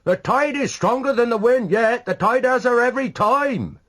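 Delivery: high, loud shrieked words; shrieking. A narrator's reading. Someone shrieking in Donna's ear in the crowd. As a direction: screech it right in her ear. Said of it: "someone else shrieking in Donna's ear".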